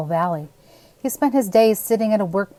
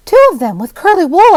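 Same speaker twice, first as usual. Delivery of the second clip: put-on much higher and louder, excited child; eager boy; 11 semitones higher, 11 dB louder than usual. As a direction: chime in like an excited little boy. Little boy adds eagerly.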